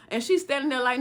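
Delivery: normal talking voice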